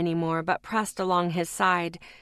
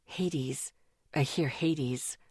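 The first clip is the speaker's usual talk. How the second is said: faint, weak voice; 6 semitones lower, 6 dB quieter, much slower than usual. A narrator's reading.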